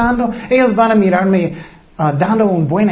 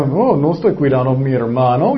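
sullen Cain; 6 semitones lower than usual. Acted. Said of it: "Y él dijo".

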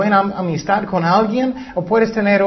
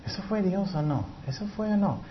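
much softer, unsure questioning; doubtful murmur; 3 semitones higher, 10 dB quieter than usual. Retold or imitated. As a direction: wonder under your breath whether it was God. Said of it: "tú eras".

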